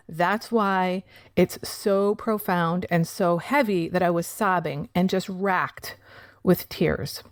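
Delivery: usual speaking voice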